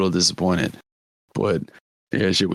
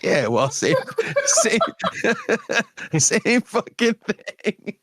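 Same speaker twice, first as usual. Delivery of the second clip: laughing through it; 13 semitones higher, slower than usual. Retold or imitated. Retelling a friend's comeback, laughing helplessly. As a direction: quote broken by high giggles, dragged out slowly. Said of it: "Jefferson was like".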